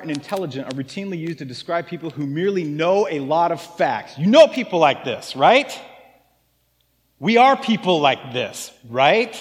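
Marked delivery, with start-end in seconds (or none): 4.2-9.3 emphatic raised voice. Real, not acted. Pastor pressing point to congregation.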